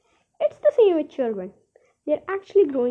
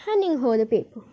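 usual speaking voice

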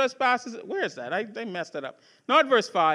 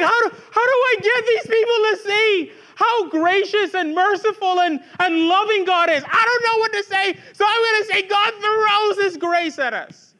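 higher, fast, at a loss for words; mock frustrated musing, pitched up; exasperated inner voice; 7 semitones higher, 4 dB louder than usual. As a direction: act out a preacher groping for words. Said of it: "You're like".

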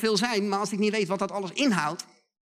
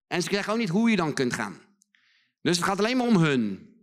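normal talking voice

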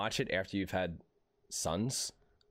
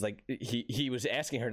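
ordinary talk, nothing marked

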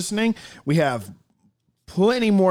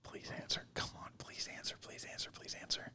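whispering; 25 dB quieter than usual. Real, not acted. Whispering while the phone rings.

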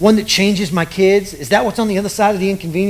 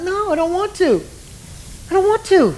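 whiny no; 10 semitones higher than usual. Retold or imitated. Husband whining he's too tired.

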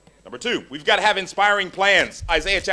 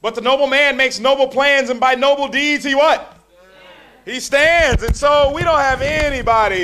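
higher, louder, ringing scripture; preaching at full force; 9 semitones higher, 9 dB louder than usual. Real, not acted. Preacher reading Isaiah to the crowd, full force. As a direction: call it out to the congregation.

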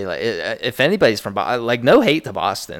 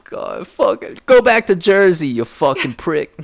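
higher, louder mock Boston tirade; angry Bostonian; 7 semitones higher, 5 dB louder than usual. Friend capping the Boston bit, hammed-up Boston rage. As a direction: bark it like a furious Boston driver. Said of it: "Boston impression".

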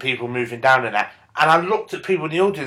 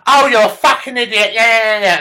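higher, louder scornful outburst; mock angry woman; 7 semitones higher, 5 dB louder than usual. Girlfriend insulting boyfriend before slamming door, mock angry. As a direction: snap it as you storm out.

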